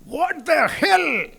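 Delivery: mock angry yell at the shop girl; furious shout. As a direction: bellow it like an enraged customer. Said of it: "he shouted".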